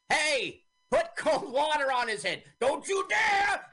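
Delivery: high, loud mock yell; retold angry shouting. Man in the box protesting cold water, high mock shouting. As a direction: shout it in protest, drawing out the end.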